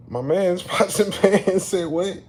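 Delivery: high, loud and slow through a laugh; laughing disbelief. Real, not acted. Comic reacting to what the email said, laughing in disbelief. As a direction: crack up incredulously, pitch way up.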